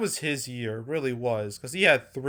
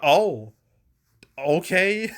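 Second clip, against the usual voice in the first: higher-pitched, halting mock surprise; mildly shocked; 6 semitones higher, 5 dB louder, much slower than usual. Thrown off guard by his cameo, surprised. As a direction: replay being caught off guard, voice lifting. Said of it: "which kinda shocked me".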